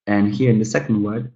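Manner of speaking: ordinary talk, nothing marked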